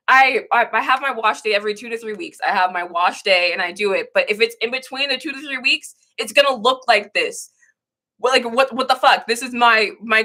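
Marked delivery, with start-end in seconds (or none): none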